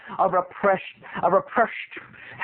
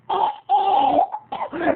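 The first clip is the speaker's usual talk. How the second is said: high laughing gasp; choking gasp; 10 semitones higher than usual. Retold or imitated.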